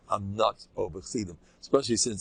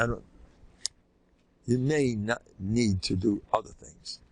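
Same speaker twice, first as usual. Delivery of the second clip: usual speaking voice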